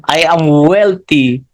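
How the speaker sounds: slow, deliberate "I am healthy"; a slow, heartfelt self-affirmation. Shown as a demonstration. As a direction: draw the affirmation out slowly. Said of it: "ilalagyan mo ng emotion".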